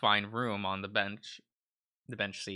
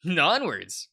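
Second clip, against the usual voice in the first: excited cheer; 6 semitones higher, 5 dB louder than usual. Acted. Character cheering.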